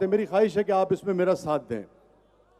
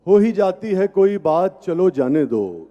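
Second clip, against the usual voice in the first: tender romantic poetry; 5 dB louder than usual. Acted. Recites ghazal, half sung.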